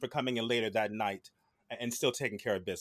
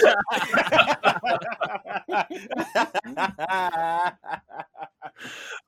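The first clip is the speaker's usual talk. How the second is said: long hearty laugh; loud laughter; 8 semitones higher, 8 dB louder than usual. Real, not acted. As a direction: burst out laughing. Big laugh at the joke.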